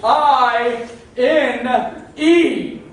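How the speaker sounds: very loud, high, slow spelling. Acted. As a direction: bellow each letter separately for someone who doesn't understand. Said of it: "You better talk louder".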